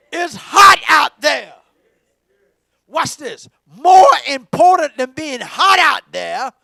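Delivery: loud, shouted gospel preaching; preaching at full force. Acted. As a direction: call the whole church in, at full voice. Preaching climax.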